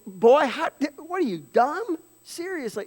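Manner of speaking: playful mock disbelief at a foolish child; exasperated parent. Exasperated parent scolding dumb kid.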